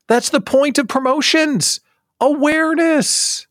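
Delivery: very high, excited proclamation; emphatic exclaiming. Real, not acted. Host telling listeners why promotions work, emphatic exclaiming. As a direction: sing out the key point in a high voice. Driving home the point.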